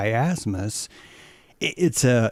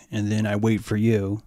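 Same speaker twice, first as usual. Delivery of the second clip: normal talking voice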